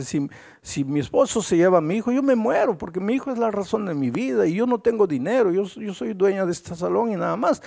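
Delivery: high, pleading voice of a mother about to lose her son; desperate woman. Retold or imitated. Woman desperate over losing son.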